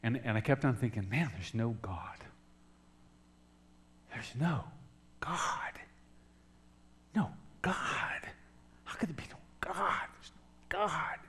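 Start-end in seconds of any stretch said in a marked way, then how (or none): 1.1-11.2 softly broken, near-tearless whisper of despair; weeping whisper. Retold or imitated. Alone at the bay fence, a whispered weeping lament. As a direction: breathe it out like faith just died. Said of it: "And I started weeping and crying".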